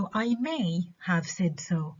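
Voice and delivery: concede, drawing out "may"; stress on MAY. Shown as a demonstration. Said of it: "بدي أنهي النقاش وأحكي له أوكي ماشي".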